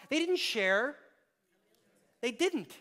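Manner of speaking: didn't lifted high and loud; emphatic didn't. Acted. Preacher hammering home that they didn't share, pressing didn't. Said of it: "emphatic repetition".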